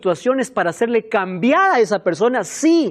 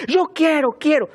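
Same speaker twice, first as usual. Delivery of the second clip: high, insistent mock child voice; demanding little girl; 8 semitones higher, 3 dB louder than usual. Pastor imitating a demanding little girl, high and insistent. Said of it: "Como aquella niña".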